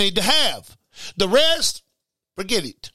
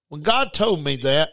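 normal talking voice

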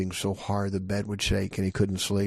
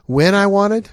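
high, loud rising question; rhetorical question; 11 semitones higher, 8 dB louder than usual. Shown as a demonstration. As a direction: pitch it way up, loud, as if asking in disbelief. Own question, answered no.

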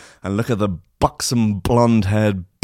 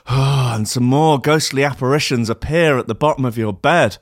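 ordinary delivery

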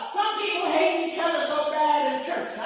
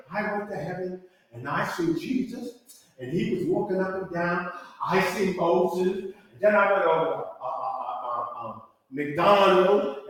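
mock visionary; 9 semitones lower than usual. Mocking people's heaven revelations.

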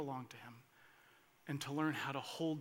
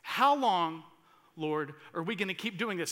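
pleading cry; 6 dB louder than usual. Acted. Voicing souls crying to God.

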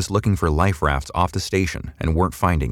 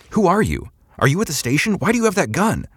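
high, agitated demand; forceful questioning; 14 semitones higher than usual. A narrator's reading. Someone in the crowd challenges Samantha, forceful questioning. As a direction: demand answers, pitched well up. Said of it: "someone forcefully asked".

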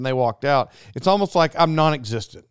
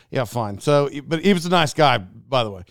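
ordinary delivery

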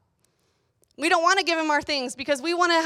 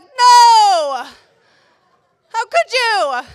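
loud, high dramatic wail; hammed-up little-kid outrage; mock horror; 8 semitones higher, 11 dB louder, much slower than usual. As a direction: cry out in high, exaggerated dismay. Three-year-old self horrified at dad.

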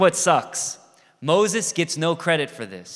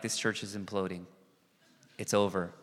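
hushed doom; 6 semitones lower, 9 dB quieter, faster than usual. Retold or imitated. Panicked self, voiced.

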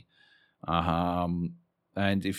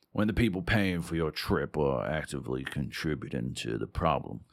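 Texan accent. Shown as a demonstration. Author trying out the first paragraph of their story, rough Texan accent. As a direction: attempt a Southern dude's Texan accent. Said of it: "the first paragraph in a Texan accent".

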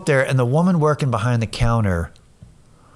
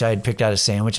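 plain reading voice

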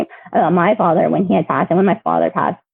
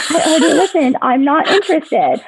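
higher, impatient brush-off with a laugh slipped in; arguing with herself; 8 semitones higher than usual. Retold or imitated. Woman arguing with herself over a grief podcast, irritated refusal. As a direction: wave it off, mildly exasperated. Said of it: "now I'm, like, arguing with myself".

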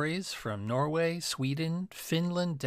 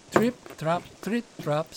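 light, crisp trip-trap, slightly higher; little goat's hooves; 3 semitones higher than usual. A narrator's reading. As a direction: tap out small hoofsteps in words. Youngest goat's hooves on bridge.

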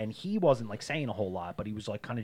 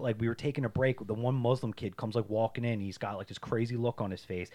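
normal talking voice

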